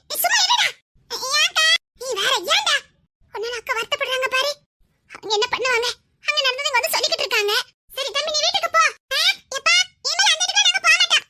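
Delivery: normal talking voice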